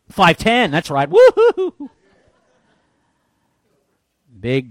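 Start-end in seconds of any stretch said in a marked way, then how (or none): 1.1-1.9 mock cheer. Mock cheer about his height.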